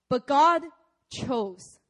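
ordinary talk, nothing marked

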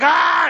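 loud, high mock yell of an eager wrestler; impatient wrestler's high yell; excited yell. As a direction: burst out with a pent-up "ah". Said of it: "he's just getting excited".

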